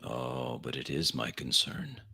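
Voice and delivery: low, soft threatening leader; menacing low voice. Acted. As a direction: purr the threat quietly.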